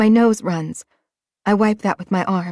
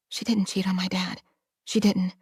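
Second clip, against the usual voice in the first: quiet; 8 dB quieter than usual. A narrator's reading. Hushed inner denial.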